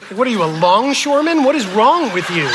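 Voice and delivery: loud, high mock outrage; appalled "how dare you" delivery, played for laughs; indignant retort. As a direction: act out an offended woman's outburst. Acting an offended woman's outburst.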